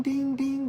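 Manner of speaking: ping it out high like a phone alert; chime imitation. Imitating the app's payment chime.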